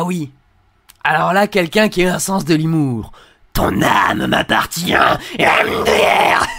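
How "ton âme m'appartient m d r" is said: slow, whispered possessed growl; eerie possessed voice reading a threat; demonic voice. Acted. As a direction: hiss it slowly like a demon. Creepy comment read aloud.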